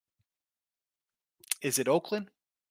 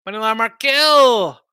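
loud, slow, higher call-out; 6 semitones higher, 9 dB louder, slower than usual. Real, not acted. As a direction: announce the pick to Markel across the room. Calling out his pick.

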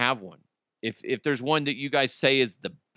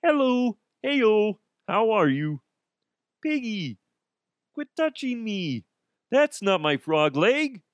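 high, goofy frog puppet voice; much higher, sing-song Muppet frog bit; Kermit the Frog; 10 semitones higher than usual. Retold or imitated. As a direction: squeeze it up into a silly frog puppet. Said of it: "Sounds like Kermit the Frog".

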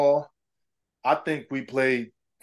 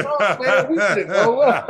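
higher, louder mock denial through laughter; coach laughing denial; 6 semitones higher, 5 dB louder, slower than usual. Retelling his coach's denial, laughing. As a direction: play the coach, laughing off the blame. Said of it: "He's like".

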